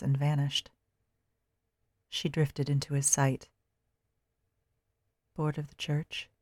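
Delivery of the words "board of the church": whispering. A narrator's reading. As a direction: breathe it close. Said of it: "he whispered".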